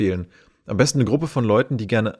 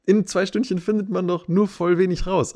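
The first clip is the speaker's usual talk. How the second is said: much higher, confused huh then protest; can't believe what he's hearing; baffled disbelief; 9 semitones higher than usual. Retold or imitated. Reacting to the friend's two-hour claim, baffled and higher. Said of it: "Und ich so".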